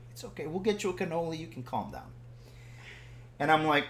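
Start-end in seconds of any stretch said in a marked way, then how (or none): 0.1-2.1 very soft coaxing voice; hushed soothing. Retold or imitated. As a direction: imitate someone gently calming a hothead. Imitates her soothing him.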